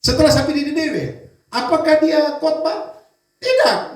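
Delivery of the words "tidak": ordinary talk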